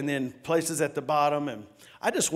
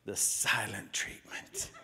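softer, lower, slow whisper; hushed whisper; 6 semitones lower, 8 dB quieter, slower than usual. Shown as a demonstration. Pastor hushing the congregation about the silent treatment. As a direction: breathe it out like a secret. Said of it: "What is it?".

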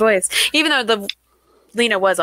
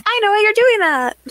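much higher, slightly louder put-on voice; mock singsong exclamation; 13 semitones higher, 4 dB louder than usual. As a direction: sing it out high in playful mimicry. Acting out a drama student's realization.

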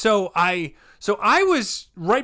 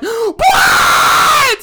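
very high, drawn-out loud cry; imitated crowd eruption; 13 dB louder than usual. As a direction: burst out like a roaring crowd. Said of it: "busted the fuck out laughing".

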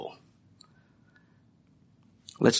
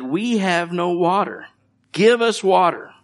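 grumbling demand; 6 semitones higher than usual. Retold or imitated. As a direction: demand water like a miserable crowd. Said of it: "They're grumbling".

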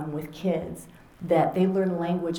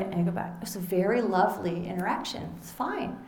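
ordinary talk

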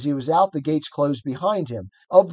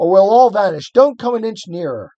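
raised urgent warning; shouting; 6 semitones higher, 5 dB louder than usual. A narrator's reading. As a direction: warn her off loudly. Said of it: "cried Diggory".

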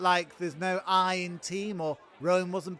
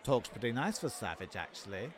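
muttered low and soft behind his hand; snide aside; 9 semitones lower, 11 dB quieter, faster than usual. Acted. Courtier muttering a snide aside about the king. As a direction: slip the insult in under your breath.

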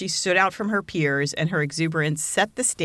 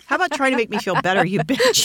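laughing mock insult; 7 semitones higher, 4 dB louder than usual. Real, not acted.

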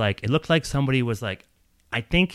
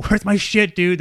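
high-pitched mock yell, a little louder; retold yell; 8 semitones higher, 4 dB louder than usual. Guest demanding his stolen stuff back, retold yell. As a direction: call it out high, imitating your own yell. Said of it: "I yell out".

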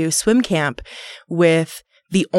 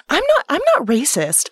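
high, defensive mother voice; high-pitched mom denying it; mom impression; 9 semitones higher than usual. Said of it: "my mom is totally the type, she's like".

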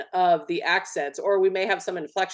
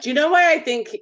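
excited; 10 semitones higher, 6 dB louder than usual. Real, not acted. Excited launch into a theory.

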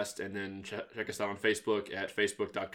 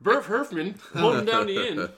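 put-on grumpy old man; 11 semitones higher, 9 dB louder than usual. Mocking voice continues the bit.

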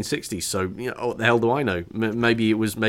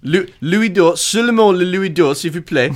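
mock French; 7 semitones higher, 5 dB louder than usual. Playing French pirate scene.

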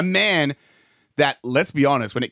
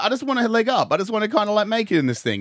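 higher, mildly pleading quote; lightly voiced pleading Randall; 7 semitones higher than usual. Podcaster voicing Randall wanting a leg up, mild plea. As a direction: plead a little, imitating.

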